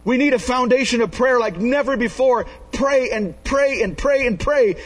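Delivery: rally the church to pray; fervent preaching. Acted. Pastor urging the congregation to pray, in full preaching voice.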